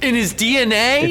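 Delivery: high-pitched disbelieving question; incredulous. Real, not acted. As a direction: echo it back in disbelief, voice jumping up. Mocking reaction to actor's explanation.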